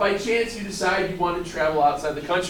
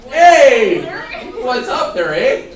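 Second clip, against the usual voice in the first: loud mock Canadian greeting; Canadian accent; 4 semitones higher, 8 dB louder, much slower than usual. Shown as a demonstration. Teacher demonstrating passing as Canadian, mock Canadian accent. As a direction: hail someone in put-on Canadian. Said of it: "with a little bit of an A".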